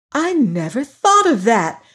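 light up at the idea; joyful. Acted. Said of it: "said Dorothy joyfully".

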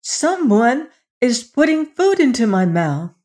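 put-on high-pitched, amazed little boy; child crying out. A narrator's reading. Queen's son being fed in the dark, crying out. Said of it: "cried the queen's son".

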